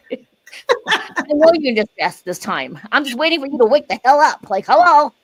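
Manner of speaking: half-joking, fed-up wake-up call, building up; exasperated scolding. Retold or imitated. Mother mock-scolding her grown kids, rising exasperated.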